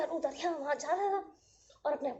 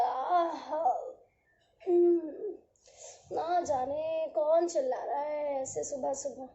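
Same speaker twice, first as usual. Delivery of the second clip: sleepy yawn; slower than usual. Acted. Sun yawning, just woken.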